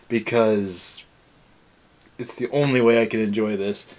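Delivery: ordinary talk, nothing marked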